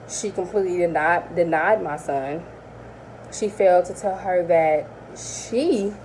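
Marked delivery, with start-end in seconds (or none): none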